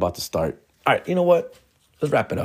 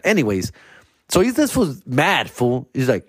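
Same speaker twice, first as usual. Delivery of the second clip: normal talking voice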